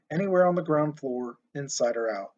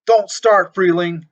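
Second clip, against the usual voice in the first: much louder, higher, furious roar across the yard; angry roar; 4 semitones higher, 10 dB louder, slower than usual. A narrator's reading. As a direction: roar back from far away. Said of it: "a distant voice roared angrily".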